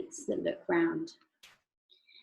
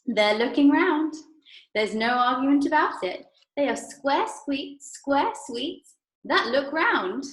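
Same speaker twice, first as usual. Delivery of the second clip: triumphant; 7 semitones higher, 5 dB louder than usual. Acted. Wonka triumphant.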